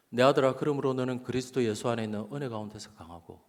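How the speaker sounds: sustained, solemn call to a son; scripture reading as God's voice. Retold or imitated. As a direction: recite the verse as if God were speaking. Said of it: "하나님의 음성이었어요". (하나님의 음성처럼 말씀 낭독)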